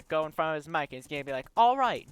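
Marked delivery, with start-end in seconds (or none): 1.6-2.1 lightly voiced YouTuber intro, raised pitch; mock hyped-up video opener; Captain Sparkles. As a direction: open loud and high, then ease off. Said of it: "Captain Sparkles is just gonna".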